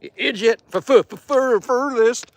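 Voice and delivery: ordinary talk, nothing marked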